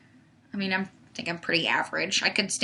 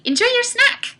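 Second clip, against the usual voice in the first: loud, high singsong send-off; much higher and louder, called out; playful call-out; 8 dB louder than usual. Real, not acted. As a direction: holler it cheerily after someone leaving. Calling out to departing viewer.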